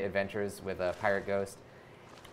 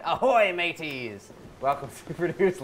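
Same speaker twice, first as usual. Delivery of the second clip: pirate voice; 4 semitones higher than usual. Acted. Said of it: "I will read it out in a pirate voice".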